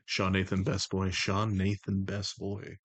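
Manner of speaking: low, measured, even put-on voice; The Voice, composed and commanding. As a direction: keep it low and level, in unhurried control. Holds The Voice.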